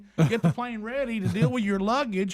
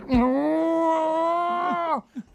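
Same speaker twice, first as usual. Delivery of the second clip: high laugh; 9 semitones higher than usual. Real, not acted. Laughs at the story.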